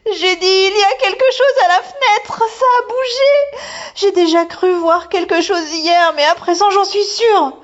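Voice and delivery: put-on a girl on the edge of crying; frightened girl. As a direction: insist in a scared rush. Girl frightened by something at window.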